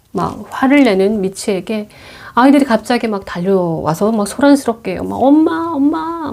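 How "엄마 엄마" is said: high, slow call for mom; scared kids calling. Retold or imitated. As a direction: cry out to mom, frightened. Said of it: "울먹이면서". (겁에 질린 아이처럼 높게 부르기)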